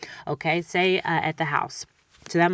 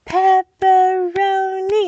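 put-on much higher, louder, slow child voice; kid clapping syllables; 12 semitones higher, 9 dB louder, much slower than usual. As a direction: chant pepperoni in beats like a kid. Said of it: "they go".

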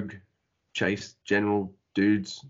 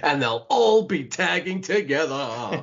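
drawn out and higher, with laughter; mock grand announcement; 5 semitones higher than usual. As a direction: hype it up slowly like a joke announcer. Mock announcement of the house show lineup.

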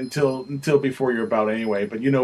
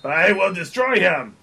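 shouted barbarian threat; 4 semitones higher than usual. Acted. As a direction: bellow it like a barbarian warrior. [name] boasts about crushing his rival.

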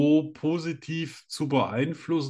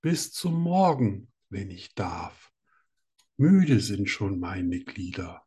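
slow, measured poem reading; poem recital; slower than usual. Acted. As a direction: recite it slowly and tenderly. Reads his own poem.

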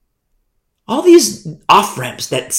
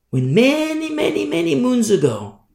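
high, stretched-out tale opening; 9 semitones higher than usual. Real, not acted. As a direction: stretch it out high, then let it fall. Opens the tale of Abraham.

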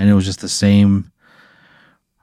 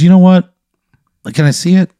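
raised, louder take-charge voice; high, projecting boss voice; booming problem-solver; 10 semitones higher, 5 dB louder than usual. Acted. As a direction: address the customer like the big man in charge. Same figure offers help.

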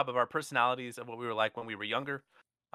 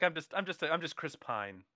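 mock humble brag; 4 semitones higher, 5 dB quieter than usual. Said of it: "being like".

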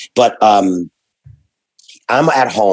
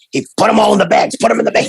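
higher, rushed panic; frantic; 10 semitones higher, much faster than usual. Retold or imitated. Retelling the frantic cleanup before the cops, higher and rushed. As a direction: hurry hurry, bag it all.

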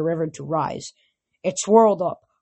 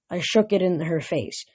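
ordinary delivery

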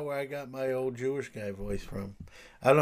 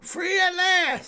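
slow, high proclaiming; booming freedom cry; preacher voice; slower than usual. Pretend. Joking about divorce party.

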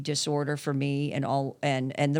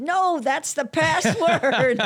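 imitating the son, higher, louder and slow; son's punchline; 12 semitones higher, 5 dB louder, much slower than usual. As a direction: deliver the reveal slowly, like a punchline.

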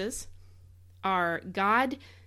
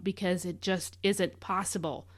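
ordinary delivery

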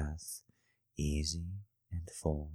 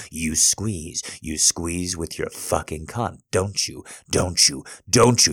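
yelling; 3 semitones higher, 12 dB louder than usual. Acted. Dominant partner yelling at lover.

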